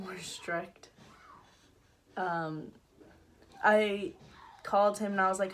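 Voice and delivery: ordinary delivery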